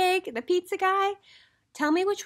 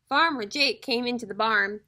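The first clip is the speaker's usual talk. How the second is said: ordinary delivery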